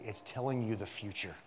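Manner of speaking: ordinary talk, nothing marked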